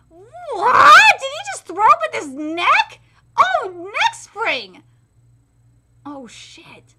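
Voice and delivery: high, loud disbelief; shocked shout. Real, not acted. Fan stunned by the move, shouting. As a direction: yell out the surprise.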